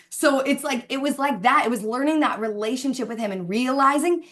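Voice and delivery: ordinary talk, nothing marked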